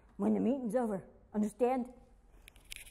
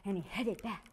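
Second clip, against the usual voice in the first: ordinary delivery, nothing marked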